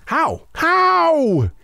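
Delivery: high HOW, the second stretched long; short HOW, then a long high wail; huge questioning how. Acted. Reprises the huge anxious "how.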